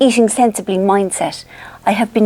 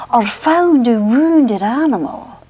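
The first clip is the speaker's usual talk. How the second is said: put-on very slow, stretched-vowel Scottish accent; milk every word like a Scottish hypnotist; Roger Elliott; 3 semitones higher, slower than usual. Vlogger savours her favourite tape line, Scottish accent impression. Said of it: "this is my best bit".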